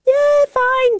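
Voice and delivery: high, singsong brush-off; carefree reply. Retold or imitated. Swimmer answering James over her coffee, carefree reply. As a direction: wave the question away in a high, airy voice.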